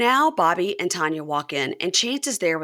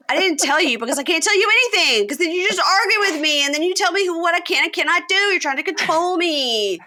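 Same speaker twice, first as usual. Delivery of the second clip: much higher and louder complaint; mock defensive whine; 11 semitones higher, 5 dB louder than usual. Chance to Taylor, high hammed-up complaint. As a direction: whine back at her. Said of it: "And he says".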